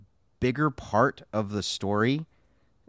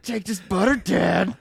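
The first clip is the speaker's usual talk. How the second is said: mock angry kid; 8 semitones higher, 4 dB louder than usual. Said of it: "He's just thinking".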